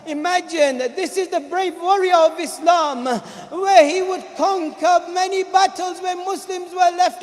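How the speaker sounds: loud, high, climbing sermon voice; rising majlis recitation; impassioned preaching. Acted. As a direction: build the grief to a peak. Preaching at full force.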